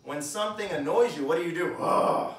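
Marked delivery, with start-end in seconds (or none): 1.8-2.3 breathy annoyed ugh; annoyed grunt. Shown as a demonstration. Preacher showing the growl when something annoys you, grunting. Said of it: "You growl".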